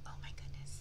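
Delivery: breathy gasp then whispered wonder. Acted. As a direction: gasp softly in amazement. Mock amazement for child.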